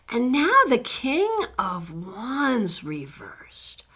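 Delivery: breathy slow whisper; whispering the card name to herself; whispered aside. Real, not acted. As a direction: drop to a hush while reading the card. Whispered aside while reading cards.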